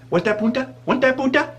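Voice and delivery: high-pitched imitated taunt, amusement breaking in; taunting Spanish lady. Spanish lady taunts in retold quarrel.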